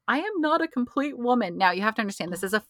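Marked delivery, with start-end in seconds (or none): 0.1-1.6 higher-pitched imitation of crying; mock tearful confession. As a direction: lightly act out a tearful sitcom lament. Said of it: "she's crying and she goes".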